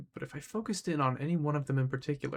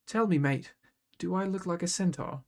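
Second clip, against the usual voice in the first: put-on British accent, higher and pointed; British-accented needling question; 4 semitones higher, 3 dB louder than usual. A narrator's reading. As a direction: challenge him in a British accent. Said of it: "British, maybe".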